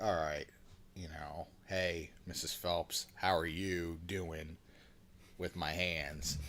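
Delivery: low, slow, quiet sleazy greeting; lightly acted creepy sweet-talk, drawn out; sly come-on. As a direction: drop low and linger on each word. Voices man flirting during pat-down.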